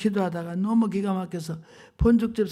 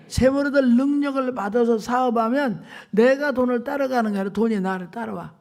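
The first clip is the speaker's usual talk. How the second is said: fiery preaching; 3 semitones higher, 5 dB louder than usual. Acted. Preaching at full force.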